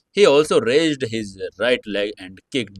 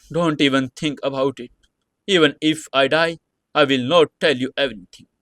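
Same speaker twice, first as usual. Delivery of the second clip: in fear; 5 semitones higher than usual. Acted. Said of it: "shaking her head in fear".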